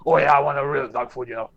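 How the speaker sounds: normal talking voice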